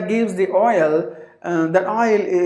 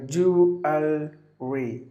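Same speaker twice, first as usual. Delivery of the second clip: syllable by syllable; 3 semitones lower, 4 dB quieter, much slower than usual. Shown as a demonstration. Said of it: "three syllables".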